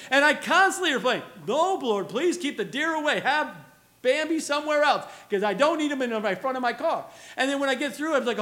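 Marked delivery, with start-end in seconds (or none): 1.5-7.1 loud, higher, insistent mock plea; animated, half-joking begging; comic pleading prayer. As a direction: plead with the Lord, playing it up. Acted anxious prayer about deer.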